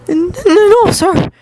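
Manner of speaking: high-pitched, timid stutter; nervous stammer. Acted. Student replying to his teacher, nervous stammer. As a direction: stumble over the refusal in a small high voice.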